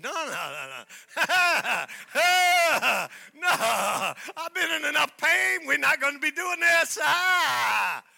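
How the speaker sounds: loud, high, pleading cry of refusal; protesting shout. Retold or imitated. Pastor shouting his refusal at the doctor, loud and high. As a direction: yell no at the doctor as if in pain.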